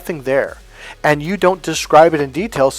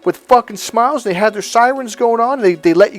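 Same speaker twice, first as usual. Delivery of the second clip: high-pitched sarcastic recap of absurd details; mock incredulous wife; 6 semitones higher than usual. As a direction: pile it up in exaggerated disbelief, voice raised. Mock wife absurdly guessing his day.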